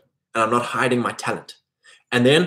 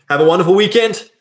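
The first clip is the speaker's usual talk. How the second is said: cheery sign-off; 7 semitones higher, 5 dB louder than usual. Real, not acted.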